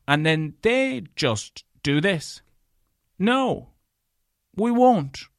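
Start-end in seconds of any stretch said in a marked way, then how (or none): 3.2-5.3 mock clamper refusal.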